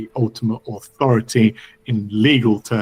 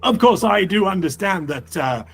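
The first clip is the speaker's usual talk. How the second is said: higher-pitched, fussy official with hesitations; flustered law speaker; 7 semitones higher than usual. Acted. Law speaker orders them to investigate the crime, high flustered urgency. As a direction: instruct them urgently, stumbling over words.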